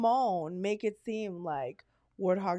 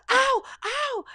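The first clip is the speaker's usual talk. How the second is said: high, loud mock cries of pain; imitated pained yelps; 6 dB louder than usual. Retelling the guy struck by a charging boar, mock yelps. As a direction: yelp like the man being attacked. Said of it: "all you hear is the guy go".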